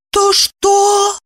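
high, anxious little question; fallen voice. A narrator's reading. Wife asking about her husband, dismayed. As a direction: ask as if dreading the answer. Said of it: "упавшим голосом спросила Евдокия".

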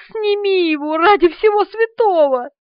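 high, desperate begging; panicked plea. A narrator's reading. Woman begs him to take the roach off, panicked. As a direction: beg frantically for rescue.

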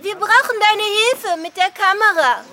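loud and high, calling across; calling over. Acted. Friend calls Rita to help with the camera, loud call.

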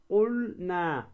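قلنا very slowly, with a held L; L stretched and separate from the N in قلنا; clear lam. Shown as a demonstration. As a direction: say قلنا as qulll, then naa. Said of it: "هكذا".

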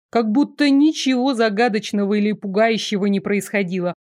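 plain reading voice

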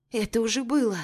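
plain reading voice

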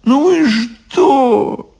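high, drawn-out brush-off; dismissive witch. Acted. Baba Yaga brushes off Ivan's worry, dismissive. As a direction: stretch it out high and unbothered. Баба Яга отмахивается от Ивана.

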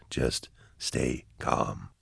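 very slow, hushed and low; slow soothing whisper. A narrator's reading. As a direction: space out each word softly to calm them. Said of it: "Why are you whispering?".

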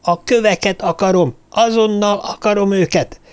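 high-pitched, furious insistence; angry, impatient. A narrator's reading. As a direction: insist on getting it right now. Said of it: "dühe és türelmetlensége tört ki". (dühösen, türelmetlenül követelőzve)

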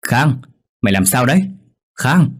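mild alarmed shout to wake a friend; calling out. A narrator's reading. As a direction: shake him awake shouting his name. Shaking friend awake, calling.

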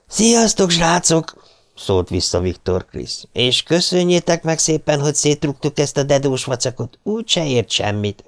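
sokkal magasabban, hangosan csúfolódva; kiabálj vissza gúnyosan, a távolból; gúnyos kiáltás. A narrator's reading. Gúnyos búcsúkiáltás a gyerekeknek. (Viktor Krisz csúfolódva visszakiált a síró gyerekeknek)

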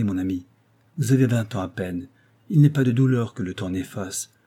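usual speaking voice

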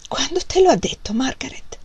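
jealous whisper. A narrator's reading. Jealous mother questions daughter.

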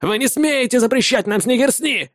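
high-pitched outraged cry; wailing protest. A narrator's reading. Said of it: "завопил Арви". (вопит высоким голосом, возмущённо)

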